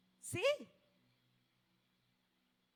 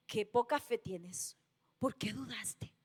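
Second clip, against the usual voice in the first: reproche suave susurrado; 7 semitones lower than usual. Acted. Jesús reprende a Pedro.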